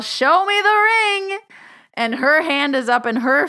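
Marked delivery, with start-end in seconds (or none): 0.0-1.4 high, drawn-out, loud shout; excited yell. Retold or imitated. Yelling at her cousin over FaceTime, triumphant high shout. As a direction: yell it in gleeful triumph, stretching the last word. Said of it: "and I yell".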